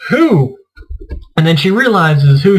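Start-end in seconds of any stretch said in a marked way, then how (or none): 0.1-0.6 high, startled double question; imitating a wife caught off guard, pitched up; surprised. Wife told to look, asking in mock surprise. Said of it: "And she's like".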